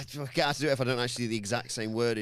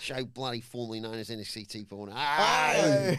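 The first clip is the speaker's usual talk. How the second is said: louder hammed-up Aussie announcement; mock Australian shout; 5 dB louder than usual. Host announcing the show in a loud mock Australian accent. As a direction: yell it like a rowdy Aussie. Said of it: "The accent has gone to his brain".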